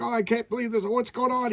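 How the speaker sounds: high-pitched mock disbelief; dismayed villain. Doctor Doom reacts to squirrels attacking him, high mock dismay. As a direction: wail it high like a comically beaten villain.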